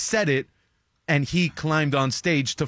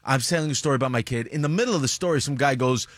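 ordinary talk, nothing marked

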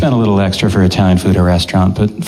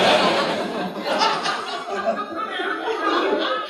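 silent laughter; 11 dB quieter than usual. Real, not acted. Laughs at his own joke.

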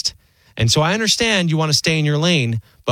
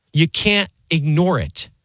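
usual speaking voice

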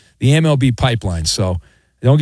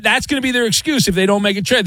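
normal talking voice

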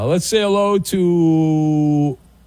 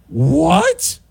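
mock disbelief; 12 semitones higher than usual. Host feigning shock at the story. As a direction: play up the shock. Reacting to Trump news.

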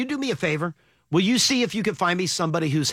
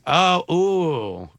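warming up a newsman impression; Tom Brokaw. Co-host requests an impression, Brokaw voice. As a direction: groan into the anchor voice. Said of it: "do your Brokaw".

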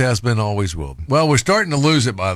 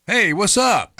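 a second, higher, louder "Hey, what's up?" to someone who ignored the first; louder repeated greeting; 8 semitones higher, slower than usual. Retold or imitated. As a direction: call the greeting out again so they have to hear it. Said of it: "I say it just loud enough".

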